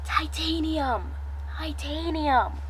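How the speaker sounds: hushed, drawn-out prompt; mouthed words. A narrator's reading. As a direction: half-whisper it slowly, as a secret hint. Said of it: "Wes mouths".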